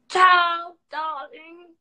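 a high, playful "Ciao, darling"; mock posh goodbye. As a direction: toss off a diva's farewell.

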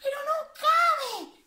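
high, loud dismayed exclaim; surprised. A narrator's reading. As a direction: exclaim he doesn't fit. Repeated, same exclamation.

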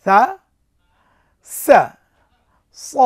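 সা light, সা with a hiss, স heavy and full; letter drill. Shown as a demonstration. Teacher drills Arabic letters for students, loud and clear. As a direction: say সা, সা, স as three distinct letters. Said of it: "প্রথমে যদি সা দিয়ে আসি".

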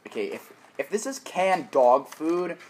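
disgusted. Real, not acted. Dreading the dog food bean.

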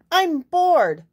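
high, loud hen squawk. Acted. Bored hen squawking at the barnyard. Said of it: "the hen squawked".

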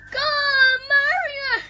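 gasping, high, loud wail; crying. Acted. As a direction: plead tearfully for urgent rescue. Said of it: "Stop crying".

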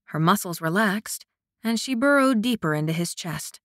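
ordinary delivery, nothing marked

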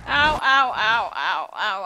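loud sharp ow cries; pained yelps. Real, not acted. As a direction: yelp from real pain. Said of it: "I just hit myself a lot".

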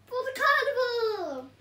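high, loud festive cheer; excited storyteller cheer; celebrating. A narrator's reading. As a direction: announce the party. Celebratory announcement.

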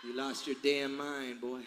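put-on low, slow Kentucky country accent; slow it down like a Kentucky country boy; soft, low Kentucky accent, drawn out; imitated Kentucky accent. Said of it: "that Kentucky accent".

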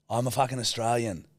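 normal talking voice

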